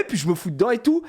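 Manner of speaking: usual speaking voice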